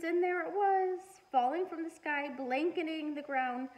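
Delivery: rising excited reveal; building wonder. A narrator's reading. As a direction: unveil the snow with wonder. Big reveal of the snow.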